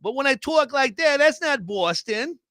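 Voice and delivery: lightly put-on New York accent. Shown as a demonstration. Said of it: "I don't think I'm doing a Boston accent".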